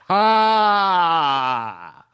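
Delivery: one long, loud, falling "ha"; loud forced laugh. Acted. Visitor laughs at own joke, loud drawn-out laugh. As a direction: hold a huge mock laugh. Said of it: "and I laugh".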